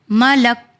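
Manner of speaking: "ملک" stopping dead on k; final vowel dropped. Shown as a demonstration. Teacher showing the pausing form, silent ending. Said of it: "اسے نہیں پڑھنا ہے".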